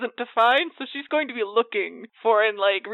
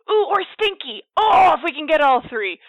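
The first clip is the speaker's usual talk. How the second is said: giddy shout of delight; excited; 6 semitones higher, 5 dB louder than usual. Real, not acted. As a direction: burst out with glee.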